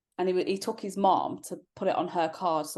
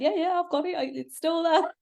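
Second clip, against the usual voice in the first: very high, jittery whispered reassurance; nervous friend; 11 semitones higher, 3 dB louder than usual. Retold or imitated. Fiancée mimics the nervous friend smuggling the ring, very high. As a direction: squeak it out anxiously, pitched way up.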